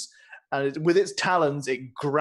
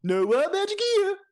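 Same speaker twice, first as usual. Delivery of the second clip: high, breezy royal denial; a vain king brushing it off; 13 semitones higher, 3 dB louder than usual. Acted. King denying wild magic to the guests, high and breezy.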